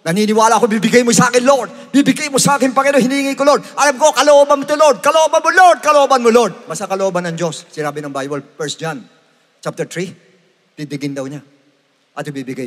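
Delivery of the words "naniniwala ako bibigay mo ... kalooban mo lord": loud, high, insistent praying; bold shouted prayer. Shown as a demonstration. Preacher shows how we should pray to the Lord, loudly. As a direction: build in force, pleading boldly. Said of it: "Minsan sana ganyan tayo mag-pray".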